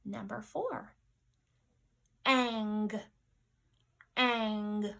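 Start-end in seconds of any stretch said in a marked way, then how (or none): 2.2-4.9 "ang" loud, isolated and drawn out; sound dictation. Shown as a demonstration. Teacher dictating sounds to pupils, loud drawn-out "ang". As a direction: say "ang" alone, loudly and clearly, then repeat. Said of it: "our sound dictation".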